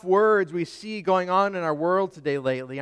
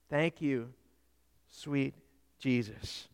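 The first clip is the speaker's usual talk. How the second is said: soft, with pauses between words; hushed prayer; 4 semitones lower, 10 dB quieter than usual. Shown as a demonstration. Preacher offers the four simple words, hushed prayer. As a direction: say it low and softly, as a prayer.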